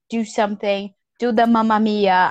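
ordinary talk, nothing marked

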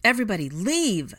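loud, high urgent command; calling out an order. A narrator's reading. Harry calls the retreat mid-fight, shouting. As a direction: shout to the whole room. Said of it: "to call out the order".

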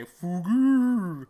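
loud, high drawn-out creature call; Pokemon cry. Acted. Big moving Pokemon calling out, loud drawn-out mock cry. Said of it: "they say".

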